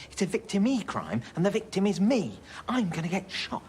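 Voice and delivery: outraged rant at his friend; indignant. Real, not acted. Heated argument, he fears being shot.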